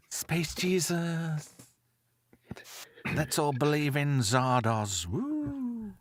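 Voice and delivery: slow, dreamy mock prophet voice; hamming up a space messiah; mock cult preacher. Friends riffing on Kilmer, mock space cult chant.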